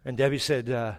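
usual speaking voice

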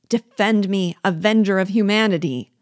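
loud, high cry for help; supplication. A narrator's reading. Victim supplicating Biassou, desperately crying out. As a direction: beg for rescue at full voice. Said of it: "a glance of supplication".

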